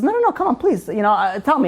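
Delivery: high-pitched coaxing plea; acted-out insistent begging; pleading. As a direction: coax a kid, imitating an eager rabbi. Rabbi begs kid to tell him.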